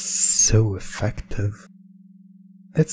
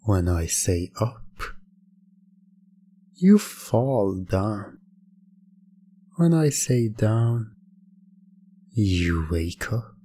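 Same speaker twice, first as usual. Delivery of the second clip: ordinary talk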